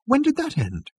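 very high, startled question; astonished. A narrator's reading. Arthur asking the waiter about the end, astonished. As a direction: pitch the question way up in disbelief.

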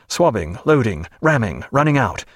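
usual speaking voice

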